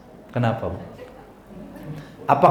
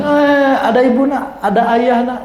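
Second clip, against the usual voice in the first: put-on cooing to a baby; 6 semitones higher, 3 dB louder than usual. Parent soothing crying baby.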